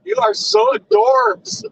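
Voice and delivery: high, slow, gushing "adorbs". Real, not acted. Praising her co-host, gushing high and slow. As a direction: coo it up high and slowly.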